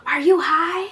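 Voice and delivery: high, loud mock-mom question; imitating mom. Mom asks daughter if she's high, shrieky mock voice. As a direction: demand it in a high mother voice.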